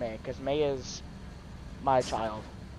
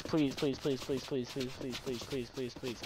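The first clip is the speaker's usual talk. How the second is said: pleading please, fading softer; begging; 3 semitones lower, 10 dB quieter than usual. Real, not acted. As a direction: beg on and on, trailing off. Begging to play the base.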